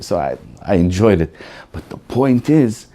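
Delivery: ordinary talk, nothing marked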